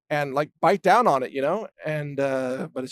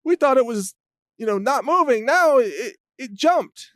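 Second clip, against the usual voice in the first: high, louder mock panic as the donkeys; panicked donkeys; 8 semitones higher, 4 dB louder than usual. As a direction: voice the startled donkeys, hammed-up. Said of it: "They're like".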